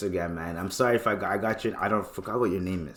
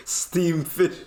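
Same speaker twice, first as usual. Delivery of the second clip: higher mock Jamaican naming, deadpan; Jamaican accent; 7 semitones higher than usual. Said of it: "They're like".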